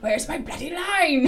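slow, loud, laughing pirate shout. Retold or imitated. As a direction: growl it out as a stage pirate. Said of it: "his pirate voice".

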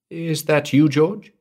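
high, anxious call into the dark. Acted. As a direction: call nervously into the dark house. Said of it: "she called".

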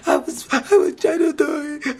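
high, whiny imitation of a sobbing contestant; mock crying whine. Mimicking Emmy's meltdown after the challenge, high and tearful. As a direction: squeak it out like she's falling apart.

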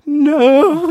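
high, loud wail of a "no"; mock crying. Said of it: "I started crying in relief".

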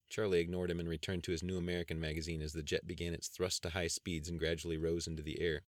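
usual speaking voice

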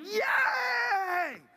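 high, loud, drawn-out yay; jubilant cheer. Shown as a demonstration. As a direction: let out a shout of pure jubilation. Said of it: "esa es la voz de júbilo".

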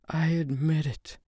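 high, strained woman's admission; high pained female voice. A narrator's reading. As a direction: force the confession out through hurt. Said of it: "she said in a pained voice".

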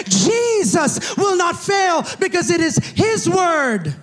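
high, loud, driving sermon climax; full-force preaching. Acted. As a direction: proclaim it with rising fervor.

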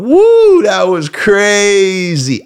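high, loud whoop, then slow and amused; drunk whoop. Retold or imitated. Waking up drunk on mom's lawn, giddy whoop. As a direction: relive the wild night with a giddy cheer. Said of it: "and thought".

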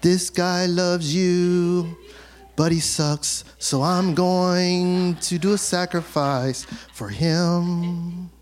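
low, slow, sanctimonious mock prayer; mock pious worshipper. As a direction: drawl it out like a fake holy man. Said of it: "you just get to be there and be like".

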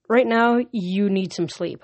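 ordinary delivery

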